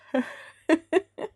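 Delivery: short high laugh; laugh at herself. Real, not acted. Artist laughing at catching the yawn.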